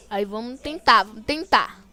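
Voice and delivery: ordinary talk, nothing marked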